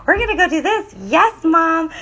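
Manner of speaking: high, playful Cinderella-style lilt; hammed-up sweet singsong, pitch way up. Shown as a demonstration. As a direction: imitate a sugary singsong voice. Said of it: "imitate that".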